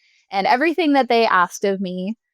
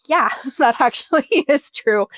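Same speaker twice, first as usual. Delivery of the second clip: laughing; 5 semitones higher than usual. Retold or imitated. Church admitting, laughing.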